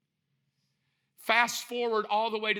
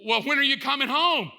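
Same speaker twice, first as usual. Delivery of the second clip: high, loud, exasperated question; scolding wife; 6 semitones higher, 5 dB louder than usual. Acted. Wife grilling husband about his plans, scolding. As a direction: pitch it up and demand to know.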